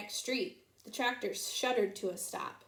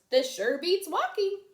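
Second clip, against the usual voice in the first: pig voice; 8 semitones higher, 6 dB louder than usual. A narrator's reading. Pigs' thought voiced.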